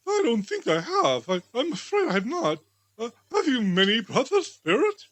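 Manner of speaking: put-on an anxious old miser trying to be polite; timid Scrooge. Scrooge answers the spirit.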